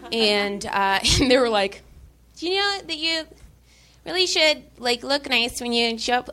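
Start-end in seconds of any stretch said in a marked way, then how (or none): 2.4-6.3 put-on voice. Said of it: "making that voice".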